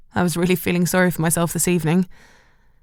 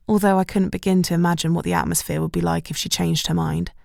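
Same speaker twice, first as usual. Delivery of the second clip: usual speaking voice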